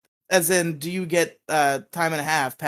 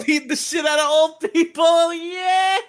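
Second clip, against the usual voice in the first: much higher, sing-song hype chant, laughing; hyped-up cheering; 12 semitones higher, slower than usual. Pretend. Hyping up his friend to fight the old man, gleeful cheering. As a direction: whoop it up like a rowdy fan.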